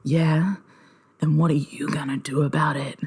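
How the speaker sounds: whispered face-to-face challenge; threatening whisper. Acted. Action man threatening another man up close, whispering. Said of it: "faces very close together and whispering".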